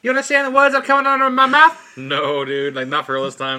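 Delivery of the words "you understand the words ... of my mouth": high, loud movie-quote impression; hammed-up incredulous yelling; Rush Hour quote. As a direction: belt it out, pitched way up. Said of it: "That's like Rush Hour".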